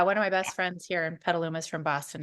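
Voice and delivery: ordinary talk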